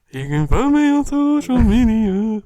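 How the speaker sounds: high, husky crooner voice; sing-song soulful croon. Retold or imitated. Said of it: "That's my [name] impersonation".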